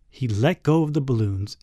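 ordinary delivery, nothing marked

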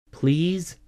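small, high rising plea; pleading. A narrator's reading. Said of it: "I pleaded".

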